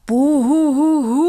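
loud, high wavering ghostly "Buhuhuhu" rising at the end; spooky ghost howl. Acted. Headmaster shows his pupils a proper spooky ghost howl. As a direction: howl it out to scare the class. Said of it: "rief der Direktor auf einmal mit gruseliger Stimme".

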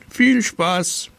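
hoch und höhnisch; spöttisch die Kinder warnen. Acted. Müller spottet über die Kinder. (der alte Müller verspottet die Kinder hoch und höhnisch)

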